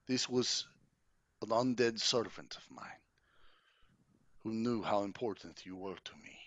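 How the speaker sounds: courtly count, contrite. Acted. Count apologizing to Irina for his servant's offense, contrite and courtly. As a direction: let each phrase fall away with grave pauses. Game master voices Strahd apologizing.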